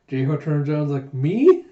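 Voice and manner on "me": high, loud questioning yelp; acted surprise. Jayha hears he is on a poster too, acted surprise. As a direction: jump up in pitch, incredulous.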